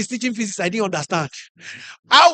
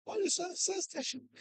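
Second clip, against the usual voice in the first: barely audible mumbled lecture; very soft, under-the-breath teacher impression; inaudible teacher; 10 semitones higher, 17 dB quieter than usual. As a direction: imitate a teacher nobody can hear. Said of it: "Sir, we can't hear you".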